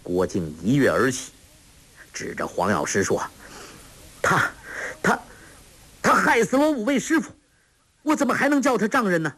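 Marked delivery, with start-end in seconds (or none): 4.2-9.3 stammered start, then high, rushed outrage; 激愤控诉. A narrator's reading. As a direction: burst out accusing, fast and high-pitched. 郭靖跃起指着黄药师控诉. (又急又高地愤怒控诉)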